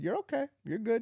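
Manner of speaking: put-on soothing baby. Mother comforting her crying toddler, high and soothing. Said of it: "And I said".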